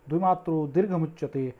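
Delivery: normal talking voice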